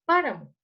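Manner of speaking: "páramo" with PÁ strong, the rest falling away; stress on PÁ. Shown as a demonstration. Teacher sounding out the stressed syllable, stress on PÁ in "páramo". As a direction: hit the first syllable of "páramo". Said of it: "la sílaba tónica es pa".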